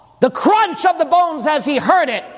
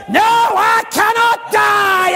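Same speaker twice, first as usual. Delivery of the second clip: slow, high and loud proclamation; triumphant shout; 10 semitones higher, 3 dB louder, slower than usual. Acted. Preacher voicing risen Jesus answering devil, triumphant shout. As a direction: ring out victory over death.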